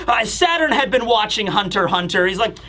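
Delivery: ordinary talk, nothing marked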